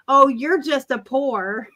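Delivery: ordinary talk, nothing marked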